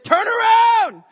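high, drawn-out yell; acted-out warning at full volume; urgent shout. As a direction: holler it across the waves. Friend repeats yelled warning from afar.

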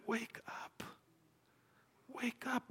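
hushed, high, intense plea; very soft, strained wake-up call; saying it quietly. Real, not acted. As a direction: barely voice it, but keep the urgency. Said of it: "sometimes saying it quietly is".

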